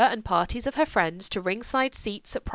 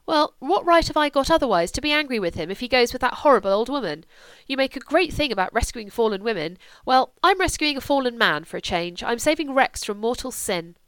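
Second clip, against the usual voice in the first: higher, louder, indignant daughter; heated argument; 4 semitones higher, 5 dB louder than usual. Acted. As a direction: argue back, heated and defiant. Julia argues with mother.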